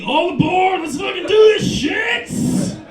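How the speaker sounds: high, loud hype yell, last words stretched; all-in shouted rallying cry. Acted. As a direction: holler like you're launching a wild ride. Acted rallying call.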